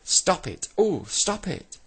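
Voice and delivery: very high, loud pleading cry; shouted protest. A narrator's reading. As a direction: yell for it to stop. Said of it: "shouted Saucepan Man above them".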